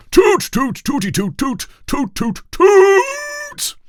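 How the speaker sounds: high, loud toot toot fanfare; mock trumpet. Royal trumpeter tooting an imitation fanfare. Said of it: "he went".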